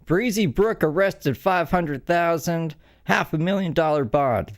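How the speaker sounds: excited. Real, not acted. Reads shocking headline.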